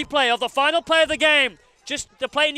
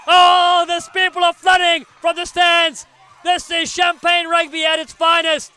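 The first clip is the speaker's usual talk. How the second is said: breathless shouting commentator; out of breath; 4 semitones higher, 6 dB louder than usual. Real, not acted. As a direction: yell it with no air left. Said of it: "I'm almost out of breath".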